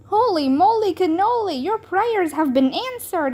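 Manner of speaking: high, bright-eyed exclaiming; excited cry. A narrator's reading. Bean tells Ivy her prayers were answered, high excited cry. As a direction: announce it like a miracle. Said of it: "cried Bean".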